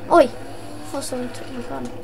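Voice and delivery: ordinary talk, nothing marked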